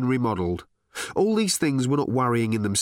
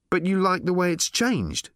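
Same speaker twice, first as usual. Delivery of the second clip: demanding; 8 semitones higher than usual. A narrator's reading. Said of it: "demanded Ford".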